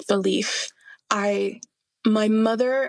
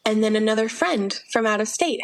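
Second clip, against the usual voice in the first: normal talking voice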